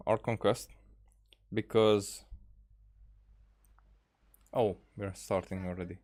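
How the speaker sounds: usual speaking voice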